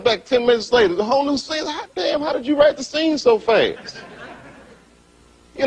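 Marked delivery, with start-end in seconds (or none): 1.6-4.0 mock amazement. Producer impressed by new scene.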